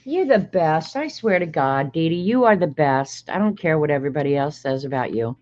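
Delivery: normal talking voice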